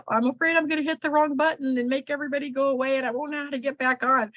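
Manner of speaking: high, hurried mock-anxious self-quote; imitating past worry. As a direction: rush it out high, like a nervous first-timer. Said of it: "I was afraid".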